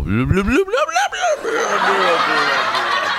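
babbled rising-then-falling time-travel warble; time machine noise. Pretend. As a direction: burble a silly time machine sound effect. (ruido burbujeante de máquina del tiempo)

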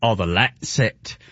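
a hint of mock-British; British accent. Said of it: "the British accent is so convincing".

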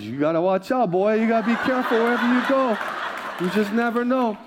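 mother teasing her son, laughing; teasing mom. Retold or imitated. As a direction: tease your son lightly. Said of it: "She goes".

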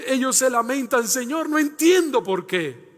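loud, ringing sermon delivery; fervent pulpit preaching; full-force preaching. Acted. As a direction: preach it out to the congregation. Preaching over music.

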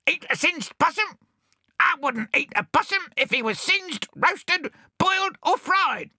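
very high, squawking shout; bad-tempered parrot. Cranky swagman parrot shouting at Bill. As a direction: snap back in a squawk. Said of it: "he shouted back".

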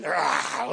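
low lion roar imitation; low preacher's lion roar; mock lion roar. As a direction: growl it like a lion. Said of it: "he's making loud noises".

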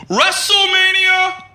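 heckler. Retold or imitated. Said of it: "a guy in the back of the room just goes".

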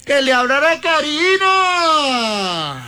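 high, long-held cry that slides down; drawn-out calling out. Real, not acted. Host begging for Karina to be let out of the bathroom, drawn-out call. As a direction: stretch it into a long wailing plea.

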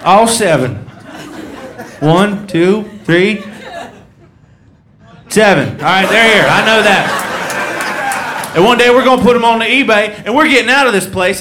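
loud, proud hollering; mock excited boast. Collector boasting to a guest, loud hammed-up pride. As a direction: count them off like a treasure.

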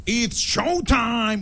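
very slow drawn-out showtime call; announcer catchphrase. Retold or imitated. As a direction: stretch every syllable for the crowd. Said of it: "dice de que".

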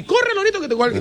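high-pitched parrot patter; parrot imitation. Owner mimicking what his parrot never learned, high parrot voice. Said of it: "nunca aprendió a decir".

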